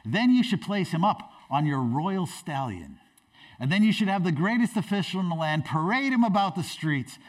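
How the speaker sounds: ordinary talk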